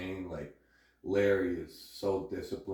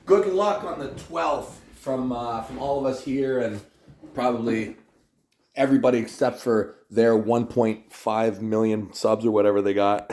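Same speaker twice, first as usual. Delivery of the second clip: loud shout-out; 3 semitones higher, 12 dB louder than usual. Real, not acted. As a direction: call out to everyone loudly. Shout-out to viewers at end.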